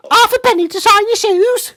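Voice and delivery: very high, loud cartoon Cockney; shrieked mock urchin voice. Host shouts a cartoon Cockney street line. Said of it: "the stereotypical British accent".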